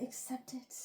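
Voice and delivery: whispered inner voice; whispered urging from the back of her mind. Pretend. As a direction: hiss it like a voice in her head.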